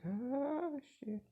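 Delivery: high drawn-out "ah"; mock high-pitched dread; caught-out dismay. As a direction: squeal the "ah", then drop the curse. Caught out by mother.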